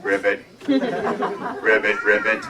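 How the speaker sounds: low-pitched frog ribbit; frog croak. Acted. Summoned monster is a frog.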